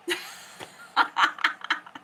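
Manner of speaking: short, breathy laugh without voice; real laugh breaking out amid tears; burst of laughter. Real, not acted. Laughing after explaining her tears to listeners, breathy burst of laughter.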